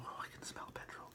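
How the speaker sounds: hushed, whispered inner voice; whispered thought. Retold or imitated. Driver thinking to himself about a petrol smell, whispered. Retold repeated thought while driving.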